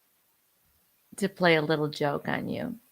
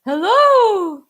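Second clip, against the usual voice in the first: high put-on voice; 13 semitones higher, 8 dB louder than usual.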